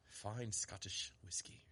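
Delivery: whispering. Pretend. Whispered aside while presenting a bottle.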